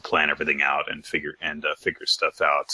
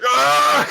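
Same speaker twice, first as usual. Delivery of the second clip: high, loud comic yell; sudden high-pitched mock shriek; mock frustrated scream; 6 dB louder than usual. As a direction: let out a hammed-up scream of frustration. Acting out his frustrated yell.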